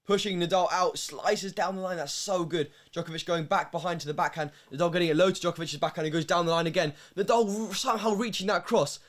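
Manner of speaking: live tennis call rising with each shot; building play-by-play. Real, not acted. Live play-by-play of a long rally.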